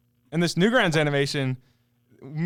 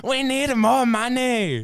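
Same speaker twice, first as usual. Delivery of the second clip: higher, slow mock Japanese accent; cartoon-parody Japanese accent; 8 semitones higher, slower than usual. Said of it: "a Japanese guy goes on screen and goes".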